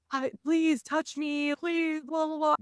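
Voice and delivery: high, drawn-out mock pleading; mock begging. Mocking Isla begging to be touched, high and whiny. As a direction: imitate a needy beg, slowly, tailing into blah-blah.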